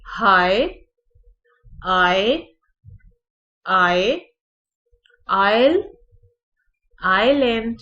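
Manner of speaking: आइलैंड said ailand with no s sound; आयल and आइलैंड with an open ai glide; loud, separate model words; careful word demo. Shown as a demonstration. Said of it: "Commonly mispronounced words हैं".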